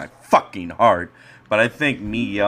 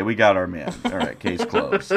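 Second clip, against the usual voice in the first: ordinary talk, nothing marked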